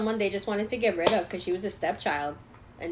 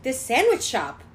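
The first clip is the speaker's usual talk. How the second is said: high, loud, drawn-out mom voice; put-on insistent enthusiasm; emphatic mom; 5 semitones higher, 7 dB louder, slower than usual. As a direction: spell it out slowly, imitating mom. Mom answers in retold scene.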